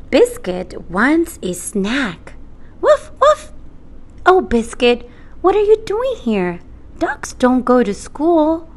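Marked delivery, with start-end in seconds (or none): 2.8-3.5 high squeaky puppy woofs; puppy bark. Acted. Puppy barks. 4.3-8.7 put-on high, fast surprised girl. Girl surprised to find puppy.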